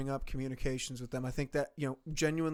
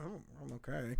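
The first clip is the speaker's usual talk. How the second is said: Mark's modest reply; 3 semitones lower, 13 dB quieter than usual. Retold or imitated. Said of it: "it's like".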